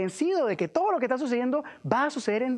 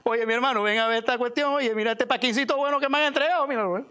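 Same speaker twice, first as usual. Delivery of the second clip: lightly put-on Cuban guy voice; hint of a Cuban accent; 4 semitones higher than usual. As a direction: play a chatty Cuban, pitched up. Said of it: "Tipo cubano".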